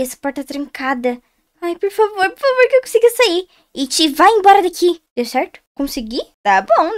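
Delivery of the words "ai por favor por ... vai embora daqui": aguda e alta, em desespero; súplica desesperada. Real, not acted. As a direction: implore alto para escapar. Tenta escapar do It. (desespero agudo)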